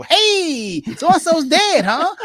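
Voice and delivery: mock a guest who's far too upbeat; inappropriately cheerful at funeral. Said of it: "go to a funeral and go".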